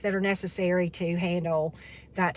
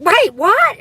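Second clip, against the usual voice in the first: startled disbelief; 12 semitones higher, 13 dB louder than usual. Retold or imitated. Friend hears stove on fire.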